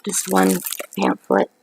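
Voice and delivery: ordinary delivery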